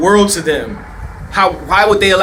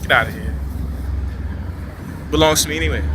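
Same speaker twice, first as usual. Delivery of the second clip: dismissive brush-off; 2 semitones lower, 6 dB quieter, faster than usual. Retold or imitated. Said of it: "so easy to just dismiss the guy".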